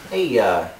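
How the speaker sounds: ordinary talk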